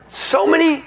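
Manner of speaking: many pushed high; high stressed so many; emphatic so many. Real, not acted. Emphatic storytelling.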